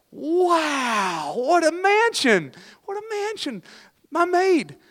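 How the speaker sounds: very high, drawn-out mock amazement; hammed-up excitement. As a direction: gush in a put-on high voice, awestruck. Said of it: "she was so excited".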